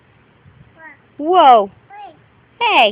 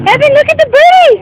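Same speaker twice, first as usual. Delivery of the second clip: higher, louder, fast and eager; excited; 6 semitones higher, 6 dB louder, much faster than usual. Real, not acted. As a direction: call her over with excitement. Calling the child excitedly.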